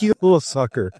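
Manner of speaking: mock insult.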